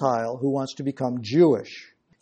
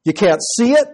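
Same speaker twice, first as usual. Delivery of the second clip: usual speaking voice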